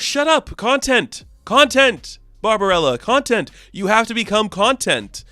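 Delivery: high, bossy demanding chant; evil director. Retold or imitated. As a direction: hammer the word like a greedy exec. Director barks at Barbarella.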